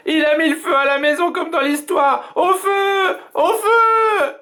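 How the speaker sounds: cris d'enfant très aigus; enfants paniqués. A narrator's reading. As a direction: crier au feu, paniqués. Children panic at fire. (enfants qui crient au feu)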